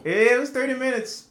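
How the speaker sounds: worked-up complaint; exasperated. Real, not acted. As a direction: stress how long it dragged on. Exasperated retelling.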